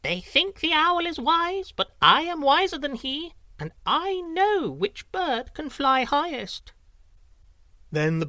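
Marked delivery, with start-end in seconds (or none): 0.0-6.7 much higher small wren voice, slowing at the end; a tiny bird's smug little plan; wren to herself. A narrator's reading. Wren plotting privately against the wise owl, high and smug. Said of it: "For she said to herself".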